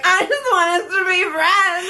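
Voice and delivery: high, drawn-out tearful wail, half laughing; mock crying. Retelling crying to her friend after a fight, mock crying. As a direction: wail it slowly as a comic retold cry. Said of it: "I started crying".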